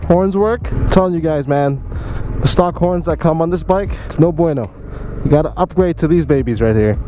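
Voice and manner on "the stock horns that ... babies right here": ordinary delivery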